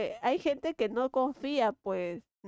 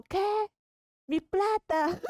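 mock shock; 10 semitones higher, slower than usual. Retold shock at losing money.

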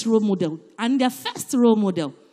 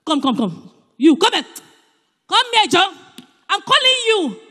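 louder and higher, barking orders; harsh summons; 7 semitones higher, 6 dB louder than usual. Shown as a demonstration. Ordering the pastor up like a servant, shouting. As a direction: yell for John to come here. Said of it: "Is it polite?".

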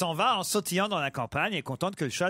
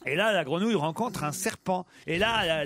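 ordinary delivery

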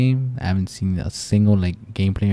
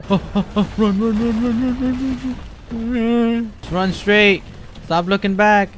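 high, loud panicked urging; frantic urging while fleeing; panicked yelling at himself to run; 11 semitones higher, 4 dB louder, slower than usual. Real, not acted. Fleeing monster in game.

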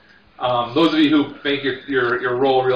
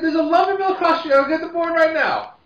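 put-on much higher, fast drunk voice; excited drunk. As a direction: rush it out eagerly. Drunk pushing the bet.

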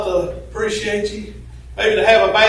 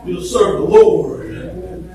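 louder, rhythmic preaching with uh fillers; fiery preaching; 4 semitones higher, 6 dB louder than usual. Acted. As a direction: preach it to the congregation, half-singing. Preaching at full force.

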